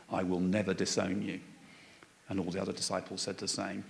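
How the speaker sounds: soft, measured Bible reading; hushed reading of the gospel text; scripture reading. Acted. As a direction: read the passage quietly to the congregation. Reads the Bible passage aloud.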